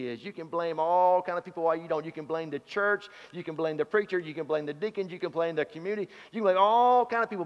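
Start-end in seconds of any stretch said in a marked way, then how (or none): 6.3-7.4 preaching climax. Acted. Preacher building the list to a climax.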